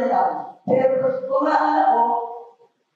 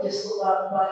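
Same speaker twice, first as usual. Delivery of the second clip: ordinary talk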